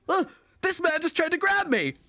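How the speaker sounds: high-pitched outraged cry for witnesses; alarmed yell. Acted. Young man accusing his grabber before the crowd, alarmed high yell. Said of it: "this young human-looking man just yells".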